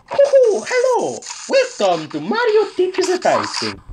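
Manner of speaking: high, bouncy Italian-accented welcome; Mario. Shown as a demonstration. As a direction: greet players in a squeaky Italian game-mascot voice. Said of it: "Now this sounds like Mario".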